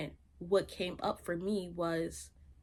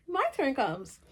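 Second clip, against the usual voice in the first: laughing; 7 semitones higher, 5 dB louder, slower than usual. Real, not acted. Still laughing, repeating.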